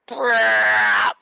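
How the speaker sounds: loud rattling Brrrr; full auto gun noise. Retold or imitated. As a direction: shout out a machine gun spray. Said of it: "I hear".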